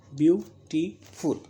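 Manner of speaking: "Beautiful" in three separate beats, view, टी, फुल; split into syllables. Shown as a demonstration. Teacher counting three syllables for students, "beautiful" split beat by beat. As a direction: break "beautiful" after view and after टी with clear pauses. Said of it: "देखिए तीन syllables में है".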